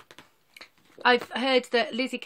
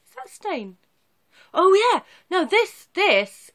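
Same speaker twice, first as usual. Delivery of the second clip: surprised; 11 semitones higher, 9 dB louder, slower than usual. Real, not acted. Reacting to stain on fabric.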